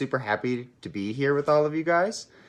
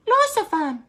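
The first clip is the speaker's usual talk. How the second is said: put-on much higher squeaky little-kid voice; little sister kitten at the yard; tiny sister voice; 6 dB louder, slower than usual.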